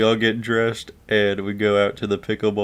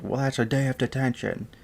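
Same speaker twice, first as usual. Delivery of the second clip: scolding teacher; 6 semitones higher than usual. Retold or imitated. Teacher acted handing out detention.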